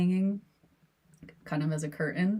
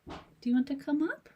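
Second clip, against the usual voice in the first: ordinary delivery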